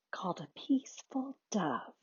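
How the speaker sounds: put-on quiet little girl's whisper. A narrator's reading. Quiet girl nobody can hear, whispering. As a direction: whisper it softly, barely audible. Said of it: "Nobody could hear her".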